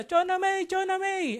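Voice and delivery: high, loud, drawn-out calling; frantic shouting. Acted. Mother running after her missing child, shouting frantically. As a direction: shout the name out high and long. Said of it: "sumisigaw siya".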